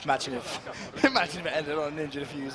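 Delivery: giggling through the words; laughing. Real, not acted. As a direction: laugh as you say it. Laugh heard.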